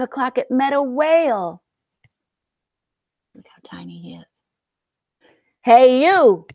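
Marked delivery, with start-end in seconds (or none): 3.3-4.3 hushed whispered aside; whispering. Acted. 5.6-6.5 grouchy shout. Acted. Grouchy ladybug picks a fight.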